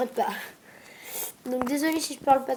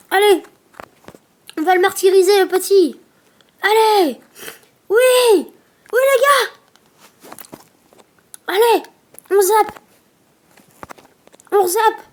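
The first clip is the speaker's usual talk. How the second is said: high, loud cheering; excited cheering; 6 semitones higher, 9 dB louder than usual. Real, not acted. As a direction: cheer it on, near victory. Cheering on the winning push.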